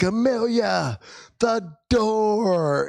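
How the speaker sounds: high, drawn-out urgent cry; retold urgent call. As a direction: call out to the child in mock panic. Urgent cry to sick child.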